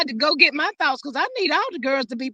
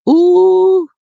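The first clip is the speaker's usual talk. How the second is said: drawn-out ooh; 7 semitones higher, 8 dB louder than usual. Real, not acted. Host reacting before closing remark.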